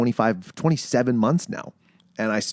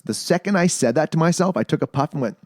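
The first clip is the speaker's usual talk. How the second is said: ordinary talk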